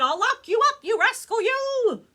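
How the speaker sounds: hammed-up furious judge shout. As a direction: bellow it down like an angry judge. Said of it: "a judge up above who shouted".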